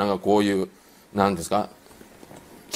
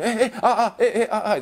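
mock flustered stammer; 10 semitones higher than usual. Flustered colleague misses the exit.

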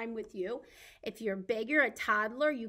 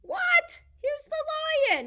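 very high, loud squeal of surprise; big pretend astonishment, pitched way up; mock surprise; 11 dB louder, much slower than usual. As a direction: gasp it out high for the little ones. Surprise reveal of lion puppet.

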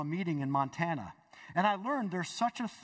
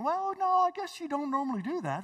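lightly acted puzzled reasoning, pitched up; mock reasoning; 9 semitones higher than usual. Balaam reasons about his talking donkey, mock pondering. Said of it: "Balaam's, like, reasoning".

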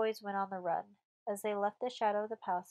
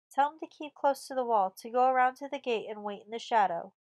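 loud, raised-pitch order; commanding; 3 semitones higher, 7 dB louder than usual. A narrator's reading. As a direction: call instructions across to the other bastion. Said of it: "commanded La Violette at once".

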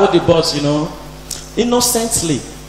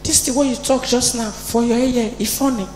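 put-on small boy voice; 5 semitones higher, 9 dB quieter than usual. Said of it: "He say".

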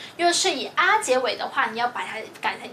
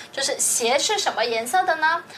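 usual speaking voice

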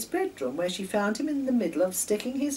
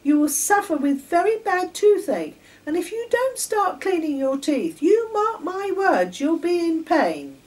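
put-on very high, loud lecturing girl voice; bossy girl; 11 semitones higher, 9 dB louder than usual. A narrator's reading. Girl lecturing Charlie on toothache, bossy and loud. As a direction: tell him off, high and loud. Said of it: "continued Patricia".

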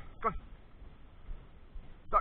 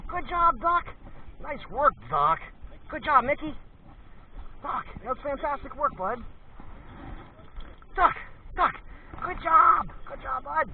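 much higher and louder, gushing over the dog's find; excited praise for the dog; 12 semitones higher, 7 dB louder than usual. Real, not acted. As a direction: burst into thrilled praise for a good dog. Celebrating the dog's successful search.

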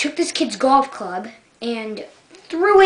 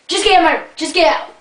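raised, higher fed-up order; exasperated; 5 semitones higher, 5 dB louder, much faster than usual. Acted. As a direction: cut the argument off, loudly exasperated. Fed up, ends acted argument.

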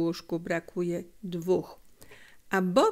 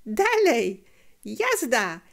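put-on louder boyish call to go; shouting boy; 3 semitones higher, 3 dB louder, slower than usual. A narrator's reading. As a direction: urge them on with a shout. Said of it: "krzyknął Felek". (okrzyk chłopca do zabawy)

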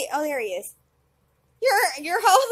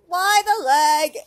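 ordinary talk, nothing marked

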